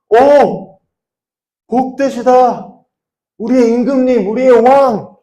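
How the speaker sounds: high, loud acted cheering; crowd's acclaiming shout. Crowd hails Jesus entering on the donkey, acclaiming shout. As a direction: cry out like a welcoming crowd. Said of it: "막 소리를 질렀단 말이죠".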